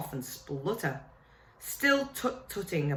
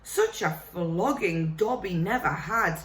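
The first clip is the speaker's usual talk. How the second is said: a fretful elf begging Harry to listen; house elf. Acted.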